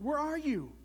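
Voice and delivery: high searching question; worried toad. A narrator's reading. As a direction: call into the dark for a friend. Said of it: "said Toad".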